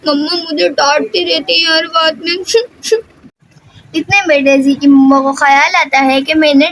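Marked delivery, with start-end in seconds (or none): 0.1-2.3 put-on hurt child complaining through tears; crying. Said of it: "रोने लग जाती है". 2.4-3.0 high, rising whimper; a crying child's high whimper; child sobbing. Acted. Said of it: "रोने लग जाती है".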